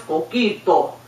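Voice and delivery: "Coquito" with pure Spanish vowels, falling; Spanish accent. Acted. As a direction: say "Coquito" as a Spanish speaker would. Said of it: "Do you hear the difference?".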